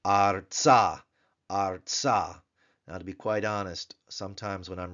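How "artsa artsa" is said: ARTsa, stress on the first syllable; teacher modelling Artsa; Hebrew word Artsa. Shown as a demonstration. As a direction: say Artsa slowly and clearly. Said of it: "we have Artsa".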